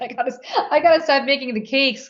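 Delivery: high, laughing through the words; laughing self-quote. Retold or imitated. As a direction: quote yourself while laughing. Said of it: "I was like".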